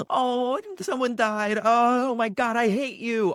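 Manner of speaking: very high mock wailing outburst; hammed-up melodrama. Coach imitating actors at a cattle call, hammed-up melodrama. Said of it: "All of that big nonsense stuff".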